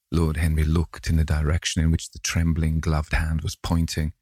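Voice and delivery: plain reading voice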